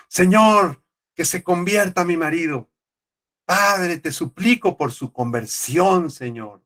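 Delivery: acted high, drawn-out plea to God; pleading prayer. Preacher voicing a wife praying for her husband, imitated pleading.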